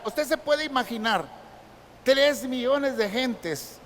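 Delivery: ordinary talk, nothing marked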